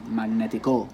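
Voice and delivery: Magnetico with the final "co" stressed, like "mag-ne-ti-CO"; deliberately misplaced stress. Shown as a demonstration. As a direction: wrongly land on the last syllable of Magnetico. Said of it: "That's not the word".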